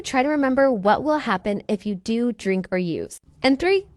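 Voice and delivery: ordinary talk, nothing marked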